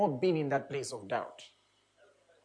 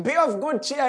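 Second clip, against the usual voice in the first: higher and louder, calling out over the storm; loud reassuring call; 8 semitones higher, 6 dB louder than usual. Acted. Jesus calling to his scared disciples, loud and reassuring. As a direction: call out to calm them.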